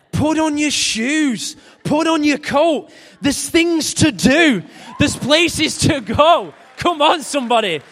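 high, slow, loud commands; preaching shout. Acted. Preacher voicing God telling his children to get going, in a slow loud shout. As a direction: bellow each order at the congregation.

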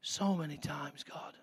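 hushed, breathless whisper; whispered prayer. Real, not acted. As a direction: let it fall to a whisper.

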